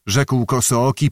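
ordinary delivery, nothing marked

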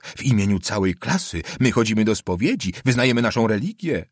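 agitated plea climbing to a high pitch; breaking into treble. A narrator's reading. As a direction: let the voice spill upward in overwrought protest. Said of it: "chrapliwym basem, który co moment przelewał się w dyszkant". (wzburzony głos przechodzący w dyszkant)

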